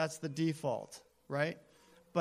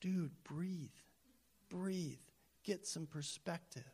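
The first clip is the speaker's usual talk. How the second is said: calming owner; 10 dB quieter, slower than usual. Retold or imitated. Owner calms the raging jockey down, softly. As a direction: talk him down gently. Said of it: "And the owner's like".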